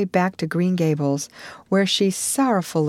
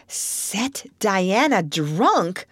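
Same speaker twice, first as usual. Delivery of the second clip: slow, stunned repetition; stunned disbelief; 2 semitones higher, much slower than usual. Acted. Marilla echoes Anne, slow and stunned. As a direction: Marilla repeats Anne's words in amazement. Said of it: "when she found her voice".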